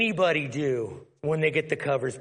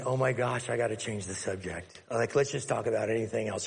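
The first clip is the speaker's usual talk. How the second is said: much softer, lower, hurried; muttered panic to change subject; quiet mock panic; anxious inner voice; 7 semitones lower, 6 dB quieter than usual. Woman's panicked inner thought.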